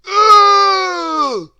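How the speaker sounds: loud, high cry of disgust. A narrator's reading. Mister Greedy tasting mud, loud disgusted cry. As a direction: spit out the vile bite with a shout. Bites cake full of mud.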